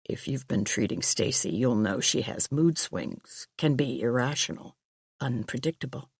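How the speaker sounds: quiet, low, measured police chief; a cautious detective, speaking softly; low male voice. Acted.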